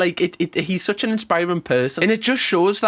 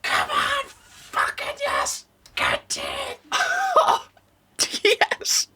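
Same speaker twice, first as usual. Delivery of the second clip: tense excited cheering; 5 dB quieter, slower than usual. Real, not acted. Cheering exam results.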